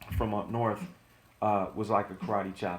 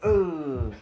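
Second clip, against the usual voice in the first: winded grunt; 5 semitones higher, 5 dB louder than usual. Retold or imitated. Hit in the chest.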